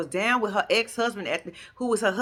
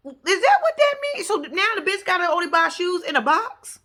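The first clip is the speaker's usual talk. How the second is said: high-pitched, incredulous ranting; baffled indignation; 9 semitones higher, 4 dB louder than usual. Real, not acted. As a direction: question it in loud, mounting disbelief. Baffled rant at shoe remark.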